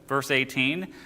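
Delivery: ordinary talk, nothing marked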